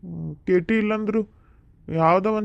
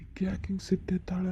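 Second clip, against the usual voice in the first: whispered aside; 9 dB quieter than usual. Acted. Husband's aside about angry wife.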